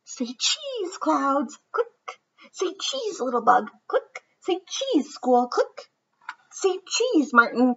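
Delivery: high, lightly voiced little girl calling say cheese; playful girl voice. Ruthie snaps pictures of everything, sweetly chirping say cheese. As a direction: sing out say cheese like a happy kid. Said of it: "She tried it out every which way".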